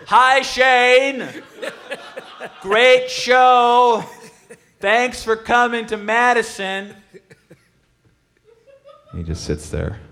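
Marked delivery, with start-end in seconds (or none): 0.1-7.0 put-on high, loud sweet old granny voice; grandmother voice. Greeting the singer backstage as a doting grandmother. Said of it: "I start talking to him like I'm his grandmother".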